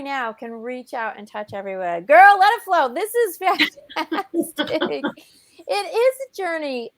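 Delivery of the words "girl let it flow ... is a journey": excited. Real, not acted. Host cheering on the emotional author.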